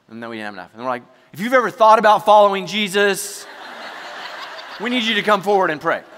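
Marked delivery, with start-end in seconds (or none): 1.3-6.0 loud, raised mock appeal; calling out. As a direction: call out to the whole congregation. Mimicking a desperate call for helpers.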